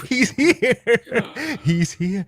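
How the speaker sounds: high, loud laughing cheer; laughing with joy. Real, not acted. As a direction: laugh it out, so happy he came. Friend arrives.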